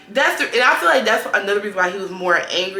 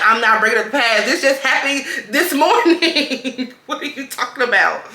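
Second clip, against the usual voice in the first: loud, laughing protest; laughing retort; 5 semitones higher, 6 dB louder than usual. Retold or imitated. Arguing with him while laughing, loud. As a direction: push back through laughter.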